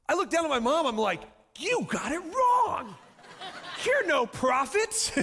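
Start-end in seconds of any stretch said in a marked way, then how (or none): 1.6-5.1 high, drawn-out comic accusation; hammed-up indignant yell, stretched words; mock accusing shout. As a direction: cry out in exaggerated outrage. Mock accusation at his mother.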